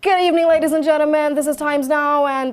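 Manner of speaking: imitating a forceful news host; Arnab Goswami. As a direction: boom out a headline debate-show welcome. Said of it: "I used to mimic him a lot".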